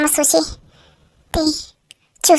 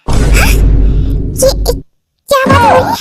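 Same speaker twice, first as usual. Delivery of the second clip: shocked outburst; 9 semitones higher, 5 dB louder, much slower than usual. Acted. Shocked outburst at mafia revelation.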